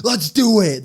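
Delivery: high, loud accepting the match; mock challenge. Host acting Cena's sudden yes, loud and high. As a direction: snap it out like a heel accepting.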